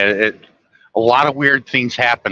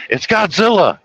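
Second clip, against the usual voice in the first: put-on coached kid line; 9 semitones higher than usual. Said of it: "point and say".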